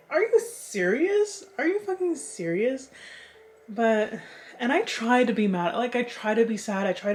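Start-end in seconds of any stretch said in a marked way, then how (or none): 0.1-2.9 incredulous. Retold or imitated. Retold disbelief at his win.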